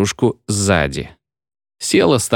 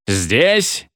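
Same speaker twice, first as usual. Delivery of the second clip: ordinary delivery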